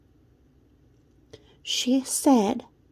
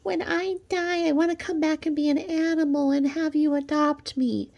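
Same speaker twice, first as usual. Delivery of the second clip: simper it like a silly pet lover; lightly voiced dreamy simper; mocking quote; 8 semitones higher than usual. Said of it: "And she said".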